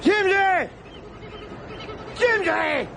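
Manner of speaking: shouting a mate's name over the noise; calling out. Acted. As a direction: yell his name to get his attention. Calling out to a friend.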